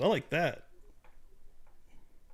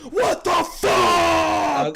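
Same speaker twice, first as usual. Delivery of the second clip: very high, long-held mock scream; drawn-out high yell, acting out the shock; retold scream; 11 semitones higher, 4 dB louder, much slower than usual. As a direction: stretch it into a re-enacted howl of disbelief. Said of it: "I screamed".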